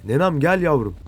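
ordinary talk, nothing marked

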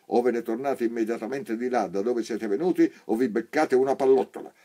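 loud, high angry orders; harsh threats. A narrator's reading. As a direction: threaten them with a gun in hand. Sergeant threatens the blind.

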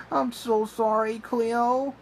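acted tearful apology, high-pitched; sobbing. A narrator's reading. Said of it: "Ryan sobbed".

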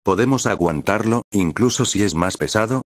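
plain reading voice

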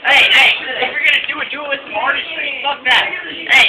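ordinary talk, nothing marked

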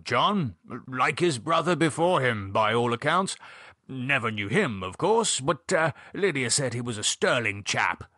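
blustering gentleman, pitch leaping on tags; hearty Gerald. Acted. Gerald praising the late John to David, blustering. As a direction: condole in a jovial old-chap voice.

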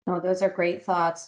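ordinary talk, nothing marked

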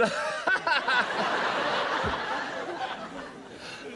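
long silent wheezing laugh; helpless high airy laugh; breathless laughter. Real, not acted. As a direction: laugh until no voice is left. Host cracks up at contestant.

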